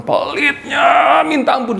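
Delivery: high, drawn-out shout of exasperation; exasperated outcry. Acted. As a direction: cry out the word pelitnya slowly in disbelief. Preacher exclaims about stingy people. (seruan putus asa yang panjang dan tinggi)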